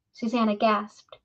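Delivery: usual speaking voice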